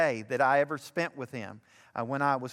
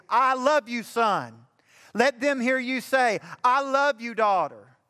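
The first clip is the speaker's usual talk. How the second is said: preaching; 5 semitones higher than usual. Acted. Preacher urging fathers from the pulpit, full-force preaching. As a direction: drive it home to the fathers in the pews.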